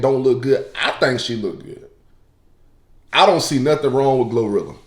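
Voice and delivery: ordinary talk, nothing marked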